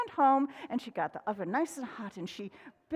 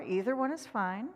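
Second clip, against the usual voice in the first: ordinary delivery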